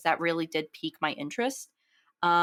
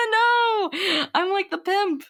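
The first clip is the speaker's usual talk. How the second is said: embarrassed outburst; 12 semitones higher, 5 dB louder, slower than usual. Real, not acted. Embarrassed at own accent.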